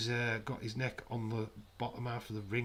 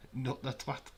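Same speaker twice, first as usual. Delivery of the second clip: ordinary talk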